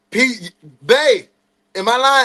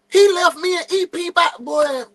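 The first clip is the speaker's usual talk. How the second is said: normal talking voice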